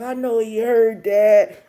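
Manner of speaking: loud, high, slow lament; mortified wail. Retold or imitated. Girl realizes her crush overheard mom, mortified wail. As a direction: stretch it out in acted embarrassment.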